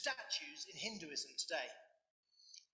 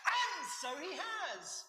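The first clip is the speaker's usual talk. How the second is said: triumphant, slow, high shout; 12 semitones higher, 4 dB louder, much slower than usual. Real, not acted. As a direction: proclaim it loud and drawn out. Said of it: "Shouting again, sorry".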